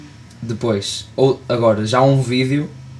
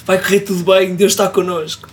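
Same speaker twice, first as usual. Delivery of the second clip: mock proud owner; 7 semitones higher than usual. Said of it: "E vais tu".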